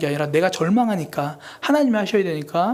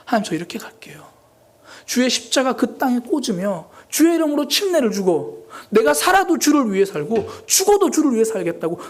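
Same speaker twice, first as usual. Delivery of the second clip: fervent vow; 6 semitones higher than usual. Retold or imitated. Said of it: "이 찬양 부르면서 혼자 울었어요".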